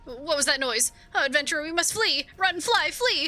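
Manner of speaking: animated, anxious little adventurer; excitable kobold Gigu. Acted. Gigu urging the adventurer to flee, excitable. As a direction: play an eager kobold who startles easily. Reads an excitable game character's lines.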